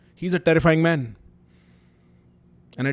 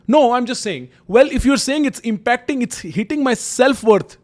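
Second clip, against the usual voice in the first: high, louder pleading retort to a parent; upset protest; 8 semitones higher, 5 dB louder than usual. Retold or imitated. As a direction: retell yourself arguing back, voice pitched up. Retold argument with dad.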